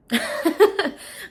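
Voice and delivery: giddy laugh. Real, not acted. Laughing at feeling too young.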